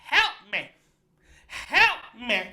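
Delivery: loud mock cry for help; imitated shouting. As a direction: call out like a demanding stranger. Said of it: "he just starts shouting".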